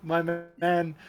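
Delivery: normal talking voice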